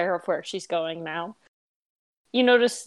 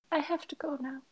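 quiet high voice; 8 semitones higher, 9 dB quieter, faster than usual. Acted. NPC leaving, quiet high voice.

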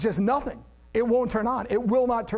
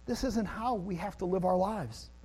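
gentle God; 2 semitones lower, 8 dB quieter than usual. Retold or imitated. God gently telling frustrated people there's another way, soft. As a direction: speak it tenderly like a loving father. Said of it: "God is looking and saying".

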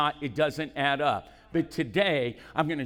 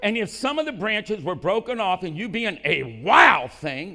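loud preaching read; 6 semitones higher, 9 dB louder than usual. Acted. Loud reading while still fired up.

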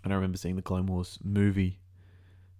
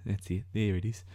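ordinary talk, nothing marked

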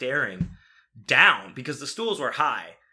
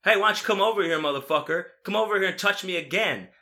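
raised, louder goading voice; acted-out dare, pitched up; mimicked taunting challenge; 7 semitones higher, 4 dB louder than usual. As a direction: call him out, imitating a belligerent drunk. Acting out the man's taunts.

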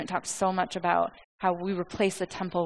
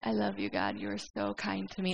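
very soft, intimate thanks to God; hushed, heartfelt devotion; tender gratitude; 8 dB quieter than usual. Acted. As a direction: murmur it like a private prayer. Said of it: "you just like".

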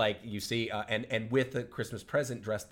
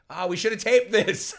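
higher, louder, slower gush of delight; mock excited; 10 semitones higher, 9 dB louder, slower than usual. James watching the broadcast, imitated excited gush. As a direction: exclaim it like a thrilled dad. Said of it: "he's like".

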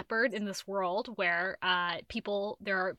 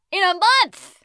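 excited exclaim; 9 semitones higher, 12 dB louder, slower than usual. Real, not acted. Bragging about reading volume.

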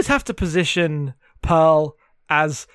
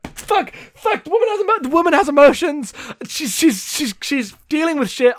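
higher, hammed-up flustered man; mock panicking husband; 8 semitones higher than usual. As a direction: play him panicking at feelings, pitched high. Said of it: "she's like".

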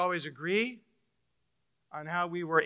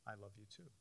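softer, choked-up thanks; tender reply; 7 semitones lower, 27 dB quieter, faster than usual. Retold or imitated. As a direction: hold her and whisper thanks. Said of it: "and said".

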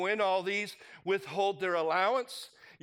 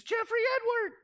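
much higher, sharp call of a son's full name; mom calling full name; 3 dB louder, faster than usual. Retold or imitated. Mom summoning her son by full name, high and sharp. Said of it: "when my mom would use my full name".

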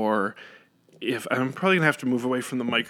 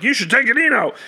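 higher, louder mock dad insisting; insistent father; 12 semitones higher, 8 dB louder, faster than usual. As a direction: push the medicine like a nagging dad. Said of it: "he'd be like".

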